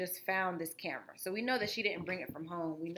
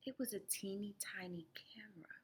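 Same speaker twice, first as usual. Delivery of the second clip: whisper; 12 dB quieter than usual. A narrator's reading. Awed discovery of tiny camera.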